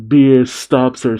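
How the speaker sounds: ordinary delivery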